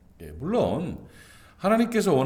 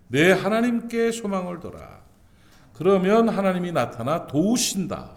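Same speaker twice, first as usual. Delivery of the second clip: 하나님께 raised and loud, a falling proclamation; proclaiming; 5 semitones higher, 5 dB louder than usual. Shown as a demonstration. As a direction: call it out to the whole church. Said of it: "능력의 말씀을 선포하는데".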